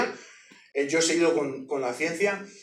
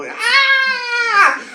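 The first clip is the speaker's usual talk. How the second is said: grito espantado; 10 dB louder than usual. Retold or imitated. Hermanas huyen espantadas.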